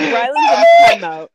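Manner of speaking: high loud playful shout; playful shout sending Rylan to timeout. Real, not acted. Shouting punishment at friend.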